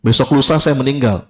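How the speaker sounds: ordinary talk, nothing marked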